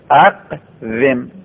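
اقوم drawn out very slowly, syllable by syllable; slow model word. Shown as a demonstration. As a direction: spell out اقوم slowly for the class. (اقوم را خیلی کشیده و شمرده بگو)

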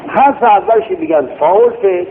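ordinary delivery

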